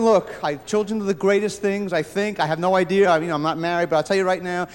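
ordinary delivery

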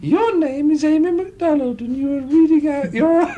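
high, loud silly showgirl; squeaky impression of someone reading aloud. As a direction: read out in a comic falsetto. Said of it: "opened up the newspaper and she said".

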